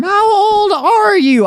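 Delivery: high, loud, drawn-out mock shock; loud incredulous heckle, played for laughs; mock outcry. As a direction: stretch it out in an exaggerated high cry. Mocks own age in outcry.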